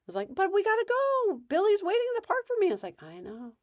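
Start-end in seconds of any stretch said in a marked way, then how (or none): 0.4-2.7 very high mock-kid whine; pleading kid. Kid whose friend waits at the park, mock urgent plea. Imitates child pleading to leave. 3.0-3.6 soft, unruffled acknowledgment; calm sympathy. Shown as a demonstration. Parent answering kid who wants the park, calm and soft. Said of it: "'cause you're being calm".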